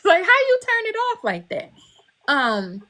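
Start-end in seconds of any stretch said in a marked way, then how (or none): 0.0-1.7 much higher, laughing through the words; laughing tease. Retold or imitated. Her friend teasing her, high and laughing. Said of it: "she'd be like".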